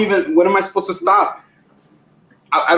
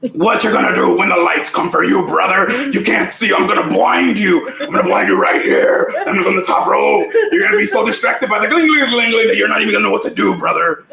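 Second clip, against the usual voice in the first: hammed-up wrestling trash talk; mock ringside rant; wrestler promo. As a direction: threaten like a showboating wrestler.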